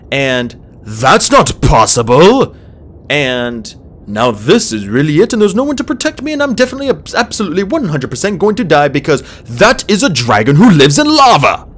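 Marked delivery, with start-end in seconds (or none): 0.8-2.5 loud, drawn-out outburst of disbelief; stunned, emphatic exclamation; alarmed disbelief. A narrator's reading. Disbelieving thoughts escalate into panic. 4.1-11.7 high, rushed ramble building to a loud cry; panicked babble peaking in near-shout; mounting panic. A narrator's reading. Terrified inner monologue escalating to climax.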